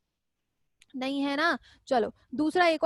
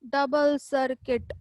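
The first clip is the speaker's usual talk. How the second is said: डबल circuit spelled out slowly, each word drawn out; slow repeat; much slower than usual. Shown as a demonstration. Teacher to students repeating the answer, slow. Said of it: "कैसा हो जाएगा बेटा".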